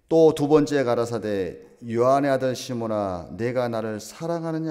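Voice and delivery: loud, slow, declaiming the verse; a slow, loud Bible reading to the congregation; solemn scripture reading. Acted. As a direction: proclaim the scripture slowly from the pulpit.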